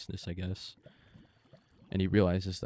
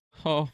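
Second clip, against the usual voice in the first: startled gasp; 9 semitones higher, 9 dB louder than usual. Real, not acted. Gasp in game fight.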